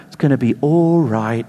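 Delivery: low, soothing British mum; British accent. Retold or imitated. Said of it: "In a British accent".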